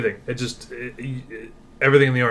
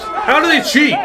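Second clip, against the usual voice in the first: mock outraged shout; 6 dB louder than usual. Real, not acted. Mock accusation of cheating.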